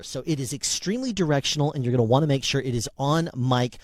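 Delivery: ordinary talk, nothing marked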